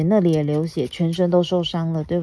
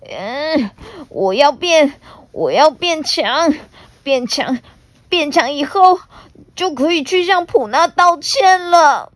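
higher, louder voice, straining and resolute; determined dinosaur; 9 semitones higher, 5 dB louder than usual. Acted. T-rex lifting the rock, determined and straining. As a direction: push yourself to get stronger.

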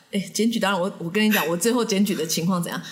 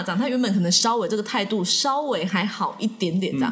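usual speaking voice

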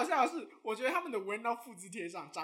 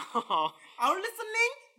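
mock calling out; 7 semitones higher, 3 dB louder, much slower than usual. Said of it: "我还一直跟他说".

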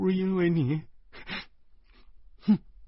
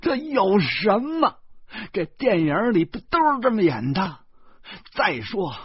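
提高嗓门愤怒地争辩; 急了眼冲着哥哥嚷; 9 semitones higher, 6 dB louder than usual. A narrator's reading. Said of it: "方志发怒了". (弟弟发怒冲哥哥嚷嚷)

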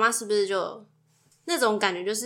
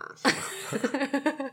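amused giggling run; laughter; 5 semitones higher, 3 dB quieter than usual. Real, not acted. Wife amused by daughter hitting her brother, giggling. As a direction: burst into soft, rolling giggles.

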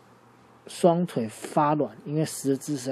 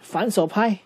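夸张的拍打音效; 7 semitones higher, 5 dB louder, slower than usual. Acted. 讲迅猛龙反手打人.

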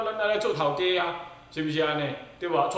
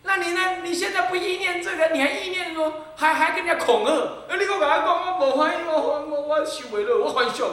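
high, louder mocking whine with a laugh; mocking a threatening disciple; 10 semitones higher, 5 dB louder than usual. Monk mocks a disciple's threat to leave, high mocking whine. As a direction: parody the disciple who threatens to disrobe.

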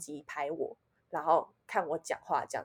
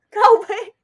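恼火骂; 13 semitones higher, 12 dB louder than usual. Retold or imitated. Angry at the police officer.

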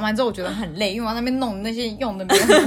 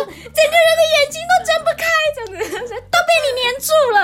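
loud high angry scolding, half laughing; mom scolding; 9 dB louder, faster than usual. Retold or imitated. 妈妈看完医生回来骂闯祸的孩子，高声怒骂.

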